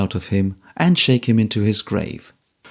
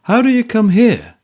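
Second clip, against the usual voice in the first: character voice; 10 semitones higher, 5 dB louder than usual. A narrator's reading. Trooper voiced in Dickens reading.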